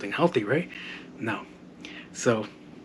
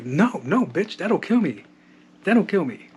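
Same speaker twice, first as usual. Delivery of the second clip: high-pitched urgent protest; mock alarm; 6 semitones higher, 4 dB louder, slower than usual. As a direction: frantically stop a well-meaning helper, hammed up. Frantically refusing the offered insulin.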